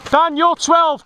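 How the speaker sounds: high, loud shout to Dan. Real, not acted. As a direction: call it out across the field. Shouting target call.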